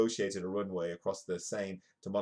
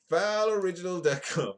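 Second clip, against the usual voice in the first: higher, louder hammed-up announcer call; announcer-style plug; 9 semitones higher, 7 dB louder than usual. Vlogger plugging his own website, hammed-up announcer shout. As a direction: boom out the web address like an ad.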